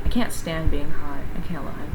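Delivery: usual speaking voice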